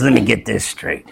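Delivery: low, hushed imitation of a father on his deathbed; dying dad's voice. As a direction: drop the voice low and soft like dad. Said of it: "he talked like this".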